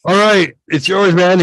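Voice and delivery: high, loud bro voice; cocky friend. Retold or imitated. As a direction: hand it over grinning. Friend handing over bong.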